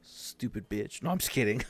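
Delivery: ordinary talk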